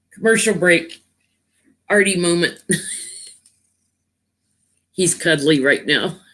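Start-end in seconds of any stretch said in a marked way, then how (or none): none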